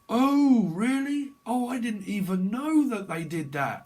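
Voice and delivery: high, loud mock gasp of surprise; mock amazement. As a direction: play the amazed onlooker.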